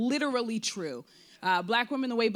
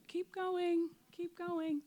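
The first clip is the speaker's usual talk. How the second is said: high, soft, coaxing voice; encouraging voices; 9 semitones higher, 6 dB quieter, much slower than usual. Retold or imitated. Books' women cheering her on to keep writing, soft and high. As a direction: urge her on gently from far away. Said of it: "these women on my bookshelf were like".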